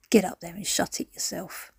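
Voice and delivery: usual speaking voice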